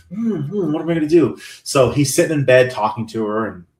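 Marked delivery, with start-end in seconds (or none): 0.1-1.4 imitated timidity. Imitating the timid doormat in bed with her, mock-timid. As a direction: dither in a small high voice. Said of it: "He's very much the timid".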